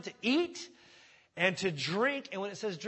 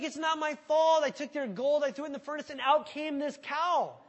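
higher, louder defensive whine; whiny excuse; 7 semitones higher, 5 dB louder than usual. Retold or imitated. Aaron whines excuses to Moses, higher and louder. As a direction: plead innocence like a caught kid.

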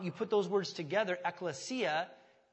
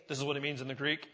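lightly voiced stock pastor line, a touch lower; mock preacher voice; 4 semitones lower, faster than usual. Pastor lightly mimicking preachers who cite the Greek. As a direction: gently poke fun at preachers saying "in the Greek". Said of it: "guys like me say".

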